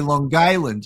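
"Long Island" with a hard g, like "Lawn Guyland"; "Long Island" said the exaggerated local way, as "Long Guy-land"; local Long Island pronunciation. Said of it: "they used to say".

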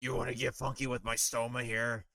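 put-on comic character voice; Family Guy reference. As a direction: lightly quote a cartoon bit in character. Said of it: "thousand fucking Family Guy references".

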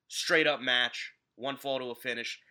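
ordinary talk, nothing marked

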